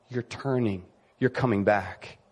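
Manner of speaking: soft and low, gently welcoming; tender welcome. Acted. Father quietly welcoming his prodigal son home, tender.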